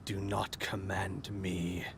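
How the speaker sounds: low, slow, sickly growl; quiet, gravelly menace. Acted. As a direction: rasp it softly, slow and deep. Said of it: "very sickly, gravelly voice say".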